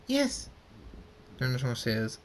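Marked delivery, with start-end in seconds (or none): 0.1-0.5 very high eager yes; excited scream. Acted. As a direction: cry it out like thrilled kids. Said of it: "They scream".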